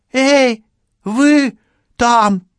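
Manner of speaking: very high, loud holler; shouted hail. A narrator's reading. Policewoman hailing the killer after getting no answer, shouted hail.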